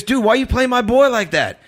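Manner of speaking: high-pitched, indignant imitation of the guy; accusing stranger. Stranger challenges him outside church, imitated high indignant voice. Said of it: "He goes".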